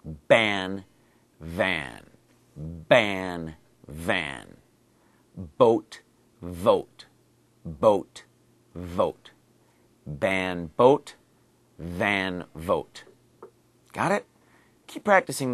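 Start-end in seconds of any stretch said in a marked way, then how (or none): 0.0-4.6 Ban with a lip pop, van with a teeth-on-lip buzz; ban versus van. Shown as a demonstration. Teacher drilling a minimal pair for Korean speakers. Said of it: "where there's a difference". 5.6-13.0 boat with a popped B, vote with a buzzed V; boat versus vote. Shown as a demonstration. Teacher drilling minimal pairs aloud. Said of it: "where there's a difference".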